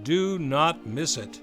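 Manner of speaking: ordinary talk, nothing marked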